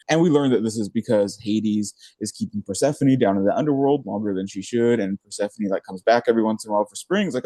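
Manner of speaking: ordinary talk, nothing marked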